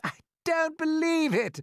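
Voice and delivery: high, loud, slow protest; shouting. A narrator's reading. Centipede refusing to believe James, high shout. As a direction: raise your voice in disbelief. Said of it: "shouted the centipede, raising his voice".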